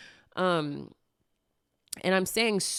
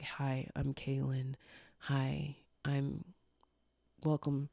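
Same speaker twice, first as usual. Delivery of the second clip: put-on old low-energy voice; 3 semitones lower, 8 dB quieter than usual. Speaker showing how they used to talk, low and subdued. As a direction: imitate your old quiet, low voice, barely putting it out. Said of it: "I used to literally talk like this".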